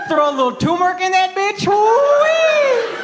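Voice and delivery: high Texas twang ending in a yelp; whooping cowboy; mock Texan holler. Cowboy voice continues.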